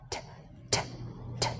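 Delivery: "T" crisp and aspirated; t sound demo. Shown as a demonstration. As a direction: flick "t" off the gum ridge. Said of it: "T sound".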